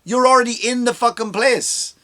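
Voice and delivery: high exasperated calling out; high mock telling-off; exasperated retort. Comic mocking a supporter, high exasperated retort. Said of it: "Can't you just go".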